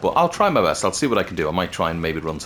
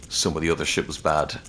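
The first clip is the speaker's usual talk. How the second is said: ordinary talk, nothing marked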